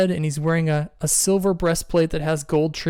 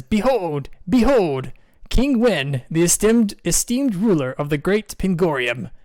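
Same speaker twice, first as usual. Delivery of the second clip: higher, grand proclamation; royal herald; 3 semitones higher, slower than usual. Acted. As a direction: announce the king like a herald. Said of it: "and he says".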